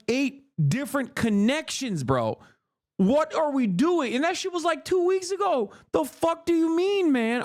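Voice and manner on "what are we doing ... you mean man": higher, building outrage at the excuse; incredulous rant. Real, not acted. Streamer ranting at the press briefing, incredulous and high. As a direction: pitch up in disbelief at the spin.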